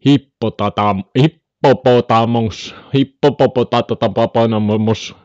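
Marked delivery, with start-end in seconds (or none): 1.2-5.1 hippopotamus with extra repeated po and ta syllables; playful stammered mangling. Pretend. Streamer goofing on hippopotamus, emphatic stuttered extra syllables. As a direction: bounce through Hippopopotatatapaponamus in staccato syllables.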